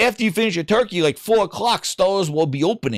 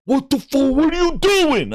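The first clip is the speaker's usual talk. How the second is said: angry manager; 8 semitones higher than usual. Retold or imitated. Angry manager confronts employee.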